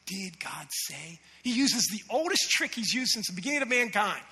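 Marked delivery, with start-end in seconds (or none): 0.0-1.2 very soft, slow, low insinuation; sly whisper. Acted. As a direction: whisper doubt into their ear. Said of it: "and he whispers".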